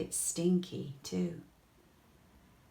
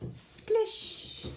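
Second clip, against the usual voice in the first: splash sound. A narrator's reading.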